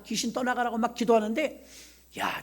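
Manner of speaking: ordinary talk, nothing marked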